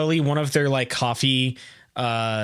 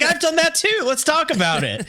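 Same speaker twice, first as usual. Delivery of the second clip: much higher, eager mock voice. The host mimics his co-host wanting to share the story, high and excited. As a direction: jump in excitedly, pitched up. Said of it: "and you're like".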